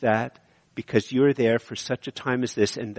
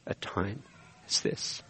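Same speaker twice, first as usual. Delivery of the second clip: whispered; 4 semitones lower, 12 dB quieter than usual. Real, not acted. Sermon line trailing into a whisper.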